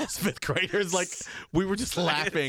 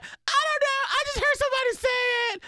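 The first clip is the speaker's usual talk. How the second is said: put-on high, fast kid excuse; much higher, innocent little-kid protest; defensive child; 3 dB louder, faster than usual. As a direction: blurt it out high like a kid in trouble. Child defends herself to the teacher.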